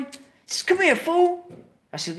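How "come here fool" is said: calling over. Retold or imitated. Steve calling him over.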